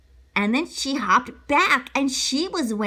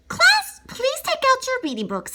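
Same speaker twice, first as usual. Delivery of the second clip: teacher voice; 12 semitones higher than usual. Acted. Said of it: "said Miss Hart".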